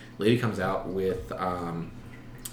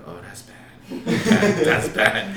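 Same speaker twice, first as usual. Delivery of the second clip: laughing while quoting; 7 semitones higher, 6 dB louder, much slower than usual. Retold or imitated. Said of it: "She's like".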